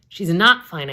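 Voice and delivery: loud, high yell. Acted. Narrator yelling at the laughing girls. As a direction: shout her down in panic. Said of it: "I yell".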